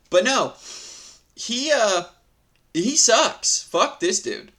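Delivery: high, loud disgust; exasperated. Real, not acted. Exasperated outburst at story.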